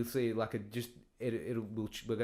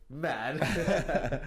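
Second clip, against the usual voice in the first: laughing; 4 semitones higher, 5 dB louder than usual. Shown as a demonstration. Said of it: "Let's try that again".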